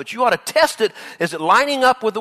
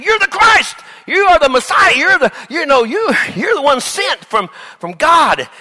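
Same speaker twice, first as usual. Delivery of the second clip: higher, louder, eager shout; excited proclamation; 9 semitones higher, 6 dB louder than usual. Acted. Peter proclaiming Jesus the Messiah, with eager shouting. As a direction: proclaim it like a thrilled disciple. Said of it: "Peter said".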